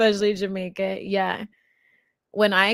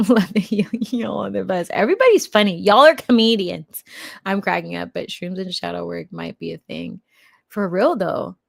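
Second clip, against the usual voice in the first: usual speaking voice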